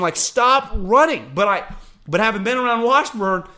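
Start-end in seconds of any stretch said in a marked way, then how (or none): 0.2-1.3 high, drawn-out exasperated yell; retold yell at the television; screaming at TV. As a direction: plead loudly with the players on screen. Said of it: "I'm screaming at my television".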